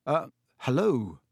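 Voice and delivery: hesitant, slow hello; a touch of nervous hesitation. A narrator's reading. Harry greets a strange creature on his bed, nervous. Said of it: "said Harry nervously".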